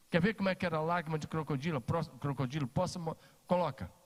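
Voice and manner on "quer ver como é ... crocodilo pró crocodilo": usual speaking voice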